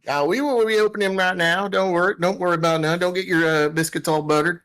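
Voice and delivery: high, easygoing put-on country voice; folksy comm operator. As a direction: chat amiably in a high rustic voice.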